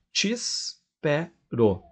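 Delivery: slow "te espero" as tis, pe, ro; three spaced syllables in "te espero", starting with tis; elided, syllable by syllable. Shown as a demonstration. Teacher counting three syllables, "te espero" slowly as tis-pe-ro. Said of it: "eu vou falar".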